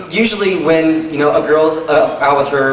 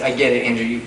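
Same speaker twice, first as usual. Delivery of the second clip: bored sarcasm; 3 semitones lower, 7 dB quieter than usual. Retold or imitated. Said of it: "I was like".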